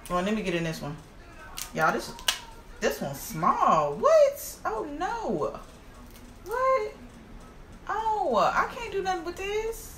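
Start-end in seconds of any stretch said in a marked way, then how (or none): 3.9-9.9 high-pitched squeal of disbelief; dismayed disbelief. Real, not acted. Eater finding the crab leg too small, dismayed. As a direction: whine in high, drawn-out dismay.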